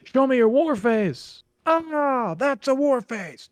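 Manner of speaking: hammed-up movie drill sergeant yell, drawn out; Full Metal Jacket drill sergeant. Drill sergeant demanding a recruit's war face, in a high slow yell. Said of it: "if you've ever seen the scene from Full Metal Jacket".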